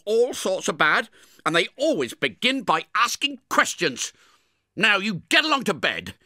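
high, loud reproaching voice; stern sister. Acted. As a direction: send the boy off to bed scolding. Sister lectures Pip.

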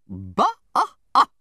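loud, explosive bursts, rising high; contemptuous scoff. A narrator's reading. Aunt scorns the doctor's report, contemptuous. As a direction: bark out scorn in short bursts. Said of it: "with such a snarl".

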